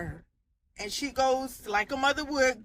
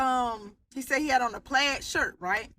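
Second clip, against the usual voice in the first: usual speaking voice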